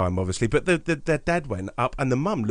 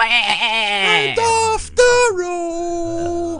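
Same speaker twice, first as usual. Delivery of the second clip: puppet voice; 7 dB louder, much slower than usual. Retold or imitated. Joining the puppet voice bit.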